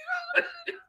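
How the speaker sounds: high squealing laugh; laughing at himself. Real, not acted. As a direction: let out a high giggle.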